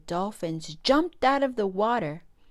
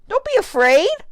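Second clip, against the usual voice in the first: high, loud reassuring call; 13 semitones higher, 12 dB louder than usual. A narrator's reading. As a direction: shout after the fleeing fish. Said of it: "Chomp called out".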